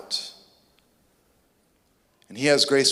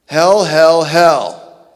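"Hell, hell, hell" shouted much louder; word hammered; 10 dB louder, much slower than usual. Real, not acted. Preacher shouting the word hell at the congregation. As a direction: pound the word "hell" loud. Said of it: "Jesus says the word hell".

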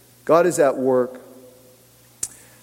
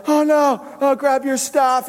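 mock worried panic; 8 semitones higher than usual. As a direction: imitate a crowd freaking out. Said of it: "freaking out, worried, like".

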